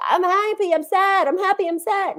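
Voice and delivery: hammed-up voice jumping from happy to sad and back; mock emotional flip-flop. Coach mocking someone stuck between two emotions, high sing-song.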